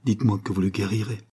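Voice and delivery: ordinary delivery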